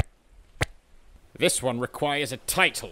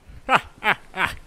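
high mocking laugh; haughty laugh; 9 semitones higher, 5 dB louder than usual. Captain scoffs at the simple suggestion, laughing. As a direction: laugh down at him. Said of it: "I must laugh at thy simplicity".

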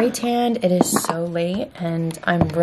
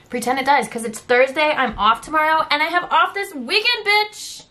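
climbing higher with glee toward the weekend; excited; 7 semitones higher than usual. Real, not acted. Vlogger cheering her weekend off, rising excitement. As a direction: build the excitement, pitch rising to the last word.

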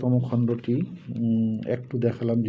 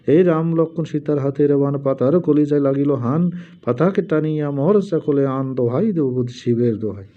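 louder chant-like recital of the charm; mantra recitation; 3 semitones higher, 4 dB louder than usual. Shown as a demonstration. Reciting the mantra again for viewers, louder. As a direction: intone the charm with more force.

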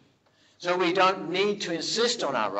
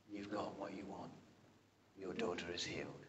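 soft, much quieter tender reply; a gentle, hushed blessing; gentle words of Jesus; 9 semitones lower, 15 dB quieter than usual. Retold or imitated. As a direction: speak it softly like a kind healer.

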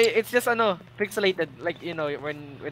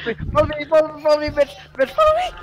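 panicked urging; 7 semitones higher, faster than usual. Real, not acted. Leading friend from monster.

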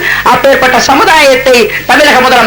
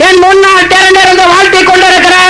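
rising, ringing rally address building to shouts; thunderous political oratory; 7 semitones higher than usual. Real, not acted. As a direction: hail the crowd like a stage orator. Rousing greeting to the crowd. (முழங்கும் அரசியல் மேடைப் பேச்சு)

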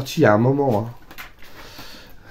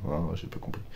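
quiet puzzled aside; 7 semitones lower, 14 dB quieter than usual. Real, not acted. Puzzled aside about the cover.

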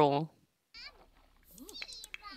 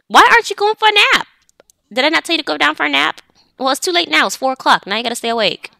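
loud, high-pitched parental telling-off; mock scolding; 10 semitones higher, 8 dB louder than usual. Mother telling child off over a missed nap, mock scolding. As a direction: play the exasperated parent, voice raised high.

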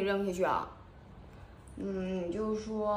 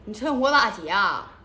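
indignant retort; 7 dB louder than usual. Acted. Outraged at kiss request.

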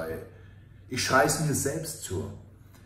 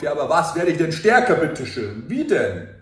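louder, mocking voice of inner doubt; 4 semitones higher, 7 dB louder than usual. Coach voicing his doubting inner voice, louder and mocking. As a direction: throw the skeptical question back at yourself. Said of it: "Und die Stimme sagt in meinem Kopf".

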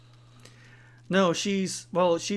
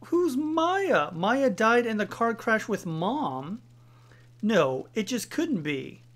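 higher, louder, drawn-out questioning; shocked disbelief; 5 semitones higher, 4 dB louder, slower than usual. Acted. Reacting to the dead Maya's name, shocked disbelief. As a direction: stretch the words in baffled disbelief. Acting out Aya's shocked realization.

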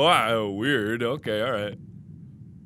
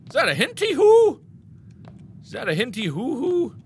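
playful sing-song; 9 semitones higher than usual. Pretend.

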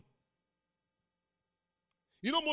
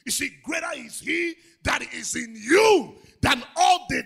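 much louder, high sermon shout; preaching climax; 3 semitones higher, 11 dB louder than usual. Acted. Pastor proclaiming that God in them is greater, full shout.

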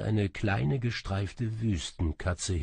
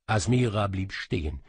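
ordinary delivery, nothing marked

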